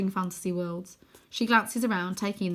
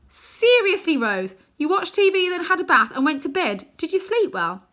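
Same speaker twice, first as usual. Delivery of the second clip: high, loud, disbelieving; sarcastic boy mocking his sister; incredulous mockery; 10 semitones higher, 9 dB louder than usual. A narrator's reading. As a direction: throw her words back at her. Arthur mocks Rose's calm report.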